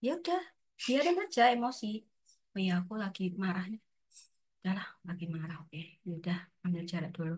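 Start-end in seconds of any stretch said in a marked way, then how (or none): 2.5-7.3 quiet calm self-talk. Shown as a demonstration.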